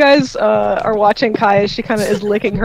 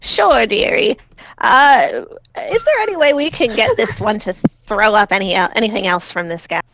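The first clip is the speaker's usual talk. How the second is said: put-on sweet old dearie; old lady voice. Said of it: "uh, old lady voice".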